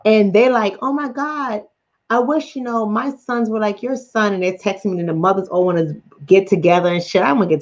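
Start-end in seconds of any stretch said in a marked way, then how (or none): 0.8-4.3 lightly mocked admiring mother, pitched up; imitating gushing moms. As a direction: quote the other moms' wishing, a bit higher. Quoting gushing mothers she disagrees with.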